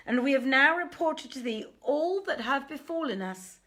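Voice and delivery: high, loud, urgent merchant voices pleading. Acted. Merchants complain to the king of robbery, high and loud pleading.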